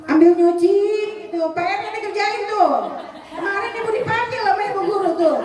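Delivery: loud, high nagging over the dishes; mother shouting orders at her child while washing. Retold or imitated. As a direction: yell at the kid from the sink.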